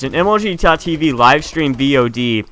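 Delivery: ordinary talk, nothing marked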